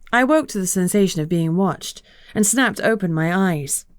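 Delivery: plain reading voice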